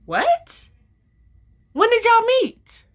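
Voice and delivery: high startled squeak of a question; shocked disbelief. Retold or imitated. Shocked at hearing they met.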